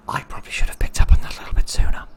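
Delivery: fast ashamed whisper. Real, not acted. Admitting to viewers he missed it, whispering. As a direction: whisper it quickly to yourself. Aside after missing obvious clue.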